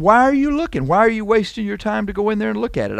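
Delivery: high mocking questions, fast on the second; mock the listener with high, quick questions; ridiculing. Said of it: "I'm ridiculing you".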